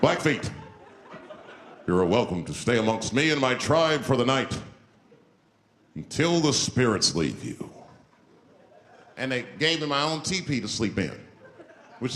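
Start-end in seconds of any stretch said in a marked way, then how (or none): 0.0-7.7 a lightly voiced solemn chief. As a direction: offer a place to stay with dignity. Chief offers teepee solemnly.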